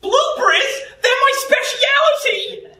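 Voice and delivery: very high, excited squeal of a voice; over-the-top delight; hammed-up excitement. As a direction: gush at a sky-high pitch. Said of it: "why you're so excited about blueberries".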